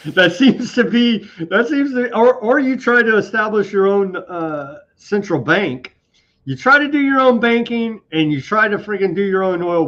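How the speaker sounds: sarcastic mock outrage. Host mocking the West's reaction to nationalized oil, sarcastic and loud. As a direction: play it as exaggerated outrage, dripping irony.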